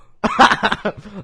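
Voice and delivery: high, loud burst of laughter. Real, not acted. Host hearing co-host's rude word, bursting out laughing. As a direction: crack up loudly.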